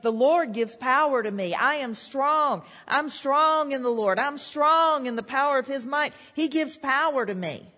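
a rising, fervent declaration; preaching at full force. Acted. Preacher declares God's strength to his congregation, fervent. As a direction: build it up like a triumphant testimony.

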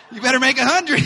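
high, very loud hammed-up yell; comic outburst of parental outrage; mock furious dad. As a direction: bellow it like an over-the-top demanding father. Mock strict father scolding daughter.

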